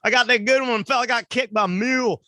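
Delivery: put-on high, twangy good-old-boy voice; Southern yokel. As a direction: brag like a proud country bumpkin. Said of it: "still bragging about it at crab eating parties".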